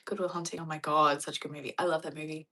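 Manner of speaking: ordinary talk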